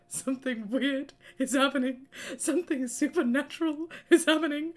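high, loud, frightened voice; scared retelling. Retold or imitated. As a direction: replay the panic of that night. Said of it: "I was so scared".

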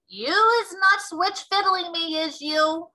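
high, loud, slow suspicious giant voice; a giant sniffing out a trick. Acted. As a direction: growl it out as a wary giant. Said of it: "said the Bloodbottler".

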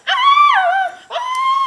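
very high piercing scream; loud high-pitched mock scream; imitated screaming. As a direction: shriek like riders on a water slide. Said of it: "and they start screaming".

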